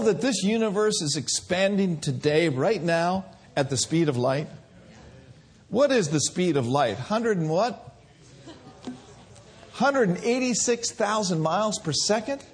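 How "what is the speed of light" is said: normal talking voice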